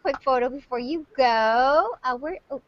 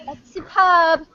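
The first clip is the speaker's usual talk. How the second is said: mock posh British; 5 semitones higher, 8 dB louder, much slower than usual.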